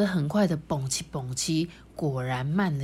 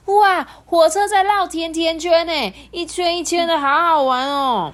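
much higher, louder gleeful squeal; delighted kids; 11 semitones higher, 8 dB louder than usual. Acted. Children delighted by the donut-shaped loops, much higher and louder. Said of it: "开心的说".

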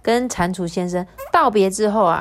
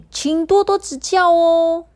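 put-on high, slow, bashful child voice; shy little girl; 10 semitones higher, 5 dB louder than usual. Dudu Xiang greets the dog shyly, bashful high child voice.

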